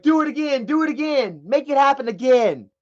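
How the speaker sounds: excited acted-out urging; retold egging on. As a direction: dare her eagerly, acting it out. Retells daring her to repeat it.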